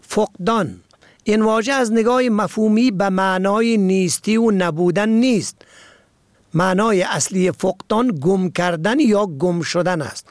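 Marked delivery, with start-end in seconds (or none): none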